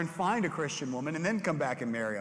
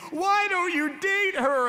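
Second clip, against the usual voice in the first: much higher, louder and slow, demanding; high mock yell; 8 dB louder, slower than usual. Girl pulling his hair, high demanding yell. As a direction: shriek it while yanking hair.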